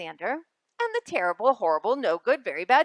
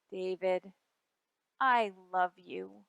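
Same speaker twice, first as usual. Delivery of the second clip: soft, slow and loving; softly soothing mother; tender; 3 semitones lower, 4 dB quieter, slower than usual. Acted. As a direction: reassure a child gently. Mother comforting child.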